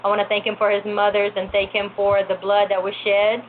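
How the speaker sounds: ordinary delivery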